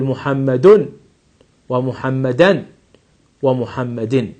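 "محمد" with -un, then "ومحمدا" with -an, then "ومحمد" with -in, each ending sounded clearly; nunation demo. Shown as a demonstration. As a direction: spell out the three tanween endings on محمد. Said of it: "أقول".

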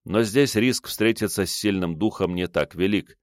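ordinary delivery

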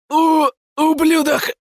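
very high, strained stammering rasp; wheezing rasp. Acted. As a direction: choke the insult out hoarsely. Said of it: "прохрипел он".

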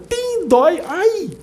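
high playful yelp; mock yelp of pain. Hit by the whip of love, mock high yelp. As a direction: cry out as if whipped, playfully.

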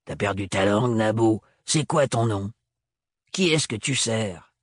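lightly voiced throaty crone; a hint of a rough old woman. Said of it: "Elle parlait fort de la gorge".

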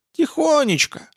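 high granny voice, falling at the end; meek little old lady, pitched well up; old woman. Acted. Old woman describes living quietly.

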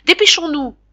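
high, loud hurry-up call; captain shouting. A narrator's reading. Captain hurrying passengers, loud shout. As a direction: bark the order over the crowd. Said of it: "Le capitaine criait".

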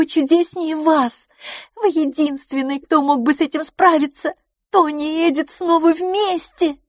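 high, loud rapturous gushing; delighted exclaiming. A narrator's reading. Miss LaFosse credits her friend with a miracle, exclaiming. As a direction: gush in a high, excited voice. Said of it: "вскричала мисс Лафос".

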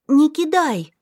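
put-on high, loud child's shout; pleading cry. A narrator's reading. Little girl shouts to stop him throwing the stone. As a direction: stop him with a desperate yell. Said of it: "схватила меня за рукав".

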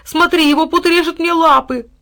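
high, loud anguished cry; pained dog. A narrator's reading. Bound dog crying to his master. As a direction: cry out in pain.

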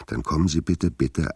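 loud, high call to come in. Acted. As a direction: call them in from across the room. Calling the women in to come.

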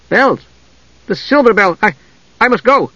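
high, urgent realization; sudden alarm. A narrator's reading. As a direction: snap awake and hurry. Mage suddenly remembers alarm.

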